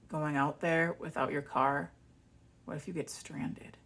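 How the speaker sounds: sinks into a whisper on the question; mock-ominous whisper of the ex; hushed ominous warning. Ex-partner fearmongering about her trip, whispering.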